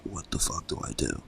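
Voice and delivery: shocked whisper. Retold or imitated. Friends' shocked reaction, retold.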